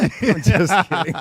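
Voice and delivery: laughing it off. Real, not acted. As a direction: giggle through the words. Laughing after joke.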